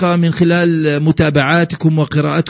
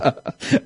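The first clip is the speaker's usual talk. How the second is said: brief, soft chuckle; a quick, quiet laugh at the caller's remark; short laugh; 6 dB quieter than usual. Real, not acted. Reacting to caller's closing remark. (ضحكة قصيرة خافتة)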